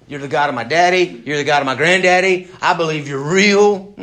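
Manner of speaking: loud, emphatic point-by-point address to God; impersonal acknowledgement. Acted. Acts impersonal prayer to God.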